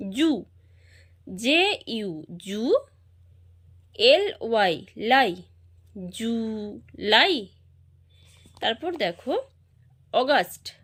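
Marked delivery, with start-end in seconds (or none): 0.1-5.3 emphatic syllables with pauses between; syllable-by-syllable spelling. Shown as a demonstration. Teacher spelling July for pupils, syllable by syllable. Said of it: "এটাকে আমরা syllable ভাগ করে পড়ব". 6.1-7.5 "July" drawn out slowly as ju, lai; syllable by syllable. Shown as a demonstration. Teacher joining the syllables of "July" for pupils, slowly syllable by syllable. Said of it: "এটাকে আমরা syllable ভাগ করে পড়ব".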